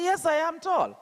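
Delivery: high, emphatic owning of it; proud retort. Acted. Tall girl answering her teasers, proud retort.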